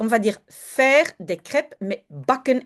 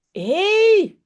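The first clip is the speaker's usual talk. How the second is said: sharp high hey, louder; shouting; 11 semitones higher, 5 dB louder than usual. A narrator's reading. Jip yelling at the naughty dog, high loud hey. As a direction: call out at the dog. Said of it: "schreeuwt Jip".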